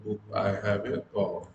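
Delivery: very slow, careful English example read. Shown as a demonstration. Teacher reading example sentences to students, very slowly. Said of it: "जैसे".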